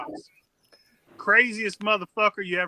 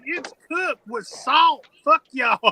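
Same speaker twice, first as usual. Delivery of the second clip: higher, slow, stubborn insistence; mock stubborn reply; 7 semitones higher, 4 dB louder, much slower than usual. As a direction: drag it out like a cranky old guy defending his lunch. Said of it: "And he'd be like".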